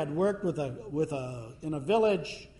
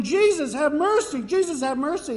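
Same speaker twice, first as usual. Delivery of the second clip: high, loud desperate cry for mercy; hollering; 11 semitones higher, 7 dB louder than usual. Retold or imitated. Canaanite woman hollering after Jesus for mercy. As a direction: call out high and loud, pleading. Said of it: "starts hollering".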